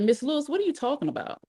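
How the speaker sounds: high, quick question; baffled protest. A narrator's reading. Alice answering the grandmother's accusation, baffled.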